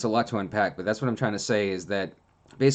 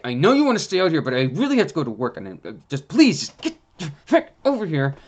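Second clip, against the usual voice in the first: higher, louder pleading that turns into a mock snap; exasperated pleading; 5 semitones higher, 4 dB louder than usual. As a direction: coax a stubborn dog, losing patience. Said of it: "And I'd be like".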